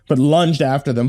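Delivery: plain reading voice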